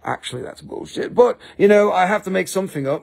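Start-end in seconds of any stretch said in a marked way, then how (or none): 0.0-1.1 whispered aside. Real, not acted. Aside admitting a lie.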